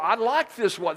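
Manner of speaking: high, loud protest; whiny husband. Retold or imitated. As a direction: plead for the old wallet.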